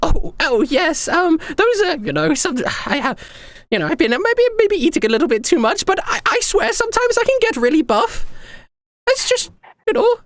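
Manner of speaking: very high, gushing and excited emperor voice; giddy young emperor. Acted. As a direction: squeal each greeting like a flustered young ruler. Same emperor voice.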